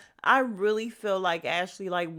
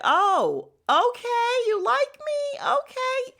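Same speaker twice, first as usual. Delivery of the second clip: much higher, louder mock eager delight; all over it; 5 dB louder, slower than usual. Ashley lapping up the girl's interest, mock eager and high. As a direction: squeal it as the flattered admirer. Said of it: "Ashley was all over it".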